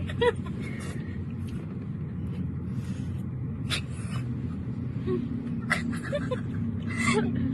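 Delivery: long breathy laugh, high and soft; helpless giggle. Real, not acted. Laughing after being offered food.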